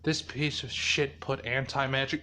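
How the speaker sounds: low, soft grumble; muttered annoyance. Retold or imitated. Izuku finding his teleport blocked, low and annoyed. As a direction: grumble it under control, low and peeved. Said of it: "And he's like".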